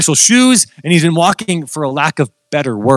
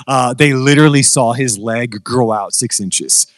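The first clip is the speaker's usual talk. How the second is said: ordinary delivery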